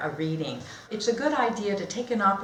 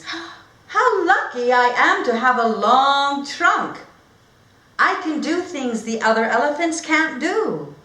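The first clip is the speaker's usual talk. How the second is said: a hint of a proud little elephant; lightly voiced, pleased inner thought; Alfred's thoughts; 8 semitones higher, 9 dB louder than usual. Alfred thinking about his trunk, lightly voiced and happy. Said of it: "Alfred thinks".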